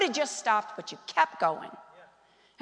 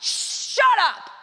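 righteously angry; 6 semitones higher, 4 dB louder, slower than usual. Acted. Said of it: "he's gotta be righteously angry".

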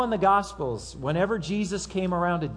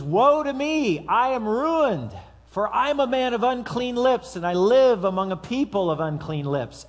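crying out; 6 semitones higher, 4 dB louder than usual. Retold or imitated. Isaiah crying out before the holy God. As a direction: cry out in dread before God. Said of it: "Isaiah cries out and he says".

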